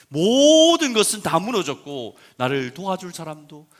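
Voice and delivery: loud, slow fervent preaching; preaching at full force. Acted. Pastor urging the congregation, fervent loud preaching. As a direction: drive the point home to the congregation.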